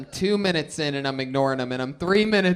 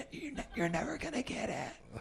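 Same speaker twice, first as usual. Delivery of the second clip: whisper; 7 semitones higher, 13 dB quieter than usual. Retold or imitated. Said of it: "The guy in the front just".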